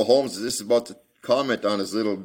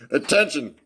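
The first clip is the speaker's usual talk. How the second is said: sharp command; 9 semitones higher, 6 dB louder than usual. A narrator's reading. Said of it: "cried Holmes in a sharp tone".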